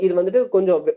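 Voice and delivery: usual speaking voice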